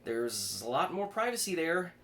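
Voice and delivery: put-on a panicked kid trying to talk his way out; flustered young man.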